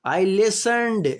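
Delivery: slow emphatic example. Shown as a demonstration. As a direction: announce the example clearly to the class. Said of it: "teacher reading tense example".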